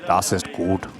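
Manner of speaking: mock German accent, low; jokey German accent, dropped pitch. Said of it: "Is that your German accent?".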